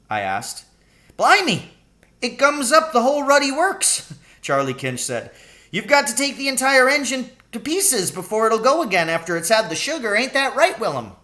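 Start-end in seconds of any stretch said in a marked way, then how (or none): 1.2-11.1 put-on much higher, cackling old cabbie voice; delighted old Charlie. Old cabbie explaining the sugar trick to Danny, high gleeful voice. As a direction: crow it out like a gleeful old rogue.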